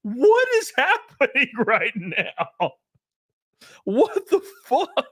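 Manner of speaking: high, slow, drawn-out bewilderment; hammed-up slow-motion shock with a laugh in it; stunned disbelief. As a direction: stretch each word out in high-pitched amazement. Stunned reaction to screaming clerk.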